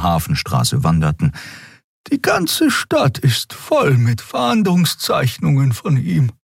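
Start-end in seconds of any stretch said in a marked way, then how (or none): 2.1-6.3 higher, slow wondering aloud; musing Xia. A narrator's reading. Xia muses aloud to Keru, higher and slow.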